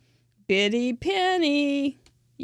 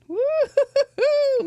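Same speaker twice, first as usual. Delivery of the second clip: high, loud falsetto woo-hoo, slowly; excited whoop; 5 dB louder, slower than usual. Real, not acted. Crafter whooping at the shirt for Mister Mike, high excited woo-hoo. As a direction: whoop with delight.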